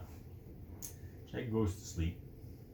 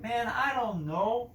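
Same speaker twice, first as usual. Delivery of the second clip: dismissive drawl; 8 semitones higher, 3 dB louder, slower than usual. Acted. Bored guard replies.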